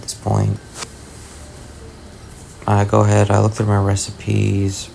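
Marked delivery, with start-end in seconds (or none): none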